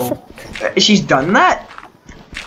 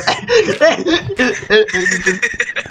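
choking fit of giggles, mimicked; imitated choking laugh; 4 semitones higher than usual. Friend mimics the other player cracking up, choking laugh. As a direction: wheeze out a mock choking laugh. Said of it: "And he's gonna go".

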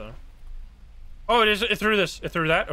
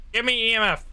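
insistent demand; 6 semitones higher, faster than usual. Real, not acted. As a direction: demand it now, pushing harder. Repeated demand to the ghost.

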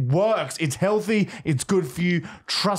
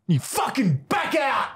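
high, mock-furious yell; acted angry shout; 7 semitones higher than usual. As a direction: yell them into backing off. Acted scolding in ad skit.

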